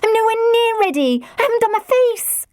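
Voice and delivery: put-on a teenage daughter squealing in panic; flustered teen girl. A narrator's reading.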